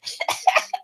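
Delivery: soft airy laugh after a shout; breathy laugh. Real, not acted. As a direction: laugh quietly to yourself.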